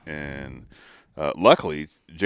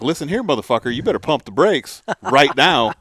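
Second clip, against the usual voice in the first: louder, higher mock tough-guy warning; mock threat; 8 semitones higher, 9 dB louder than usual. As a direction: warn the auctioneer off, hammed up. Retold threat to the auctioneer.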